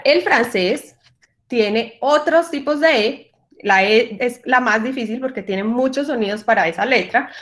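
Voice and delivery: ordinary talk, nothing marked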